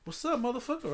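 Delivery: high-pitched retold tough-guy taunt; mock tough challenge. Drunk man picking a fight with an amused stranger, mock tough. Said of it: "Like, I'm so fucking tough".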